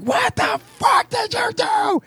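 very high, drawn-out mock yell; retold yelling. Retelling a yell heard from the office, high mock yelling. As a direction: stretch it out in a high imitated holler. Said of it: "I hear yelling coming from".

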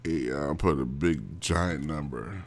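slow, low, drawn-out delivery; slow and heavy, trailing downward; slow talking. Real, not acted. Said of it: "you talking kinda slow".

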